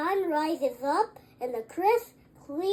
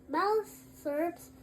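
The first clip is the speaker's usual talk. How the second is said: ordinary delivery, nothing marked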